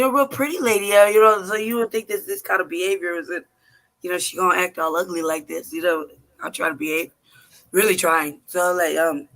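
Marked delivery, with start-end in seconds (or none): none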